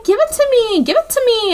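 put-on high, insistent, childish grabbing; high needling repetition; mock demanding. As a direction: demand it like a bratty kid. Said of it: "she's like".

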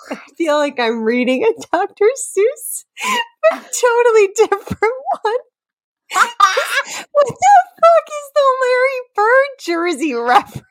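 high, breaking up with laughter mid-sentence; can barely get the words out for giggling; real helpless laughter; laughing. Real, not acted. Cracking up over the lyrics.

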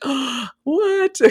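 high, stunned little "what?"; as if caught off guard by a crush; startled gasp. Retold or imitated. Six-year-old girl hearing she's his girlfriend, stunned and high. Gasps at the boy's compliment.

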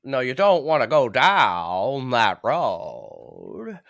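put-on very slow, drawn-out Maine accent; exaggerated Maine accent. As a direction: drag every word out in a broad Maine accent. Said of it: "people don't talk that slow".

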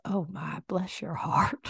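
soft, whispery Texas grandmother; Texas accent. Retold or imitated. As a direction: imitate a Texas grandma's hushed pity. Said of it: "it was in a Texas accent".

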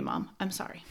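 ordinary delivery

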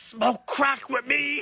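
high, slow drawn-out voice; movie character voice. Retold or imitated. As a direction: stretch it out like a wild party boss. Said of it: "he's like".

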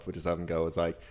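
ordinary talk

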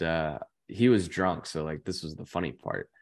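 ordinary delivery

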